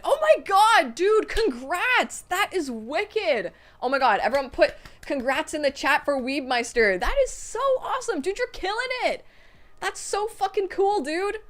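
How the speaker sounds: high, loud cheering; excited congrats. Real, not acted. Streamer cheering a viewer's new nurse job, high and excited.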